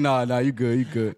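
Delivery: low backing-off voice; placating dismissal. Retold or imitated. Clerk backing off from asking for ID, placating. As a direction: drop the pitch and wave it away.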